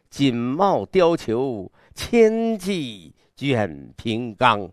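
极慢地拉长每个字，气势铺开; grand, drawn-out declamation, each word stretched; 阵势浩大. Shown as a demonstration. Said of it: "这阵势". (浩大阵势的朗诵腔)

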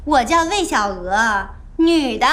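slow, exaggeratedly spelled-out answer; mock compliance. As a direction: answer with insolent deliberateness. Grudging answer to police.

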